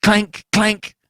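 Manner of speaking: high, loud clanging noise; imitated clank. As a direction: ring out like a falling metal basin. Basin rolling down stairs.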